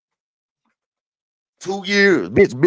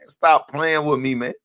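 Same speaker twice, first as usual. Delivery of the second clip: normal talking voice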